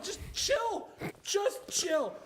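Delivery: high, emphatic plea; exasperated shout. Acted. Telling screen actors to tone down, exasperated. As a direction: shout it at an overacting performer.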